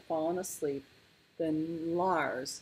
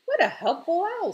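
high, loud, delighted remark; impressed little bear, pitched up; admiring; 14 semitones higher, 8 dB louder than usual. A narrator's reading. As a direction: exclaim it with enthusiasm. Lars admires the departed owl.

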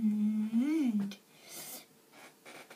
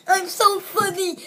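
laughing, higher and louder; 6 semitones higher, 5 dB louder than usual. Real, not acted. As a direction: giggle through it. Laughing at own joke.

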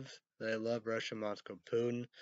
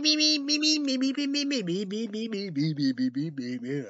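beep, beep high and loud like a censor tone; mock censor bleep; 11 semitones higher, 7 dB louder than usual. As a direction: imitate a TV bleep with your voice. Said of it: "That's a great beep".

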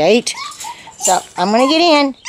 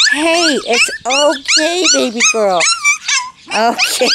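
soothing puppy; 5 semitones higher than usual. Real, not acted.

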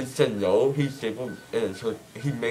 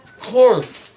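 high, loud burst; emphatic exclaim; 12 semitones higher, 11 dB louder than usual. Real, not acted. Asked about bad words.

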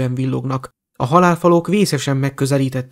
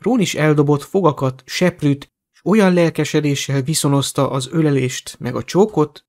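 ordinary delivery

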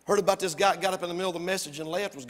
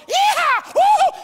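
very high, loud yeehaw and whoop; cowboy holler; 14 dB louder than usual. Acted. Cowboy across the herd hollers at longhorns, whooping. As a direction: whoop it up driving cattle. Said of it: "the guy on the other side's got his hat off".

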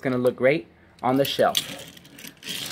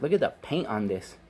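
ordinary talk, nothing marked

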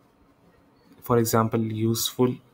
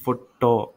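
Photo broken out as fo-TAW; stress on second syllable. Shown as a demonstration. Teacher isolating the stressed syllable, weight on TAW. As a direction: lean hard on the TAW of photo. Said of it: "इस syllable पे ज़्यादा stress दिया".

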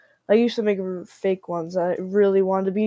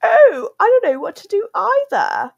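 very high, louder mock squeak; imitating Freddy voice; 13 semitones higher, 6 dB louder than usual. Vlogger recreates old Freddy voice, very high mocking squeak. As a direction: ham up the squeaky plush voice. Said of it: "I can't even recreate it".

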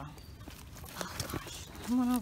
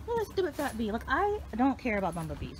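ordinary delivery

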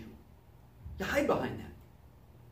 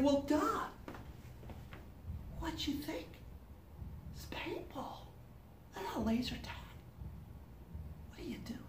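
soft, higher, teasing put-down; mocking sarcasm; 7 semitones higher, 14 dB quieter than usual. Mocking a paintball newbie, soft and sarcastic. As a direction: tease them under your breath. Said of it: "And you're like".

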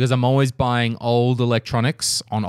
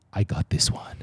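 whispered aside; 3 semitones lower, 7 dB quieter than usual. Retold or imitated. At the shop, whispering to Carl in secret. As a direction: whisper it under the breath. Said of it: "I was like".

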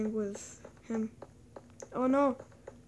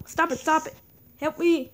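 panicked shout; 4 semitones higher, 5 dB louder than usual. Real, not acted. Attacked by mobs in game.

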